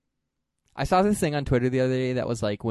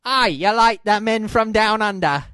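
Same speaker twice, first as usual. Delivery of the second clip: high, loud hammed-up Aussie; mock Australian accent; 10 semitones higher, 10 dB louder than usual. Teasing co-host who likes Australians, loud mock Australian accent. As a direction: blare it in a cartoon Australian accent. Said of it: "kinda also into Australian accents".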